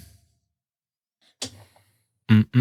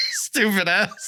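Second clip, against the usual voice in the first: laughing; much slower than usual. Real, not acted. Cracking up at a joke.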